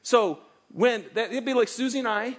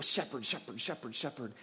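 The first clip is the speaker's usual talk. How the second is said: "shepherd" repeated much softer, trailing off; fading repetition; 8 semitones lower, 11 dB quieter than usual. Real, not acted. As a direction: let each "shepherd" fade away. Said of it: "for three weeks in a row".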